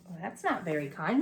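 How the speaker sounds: usual speaking voice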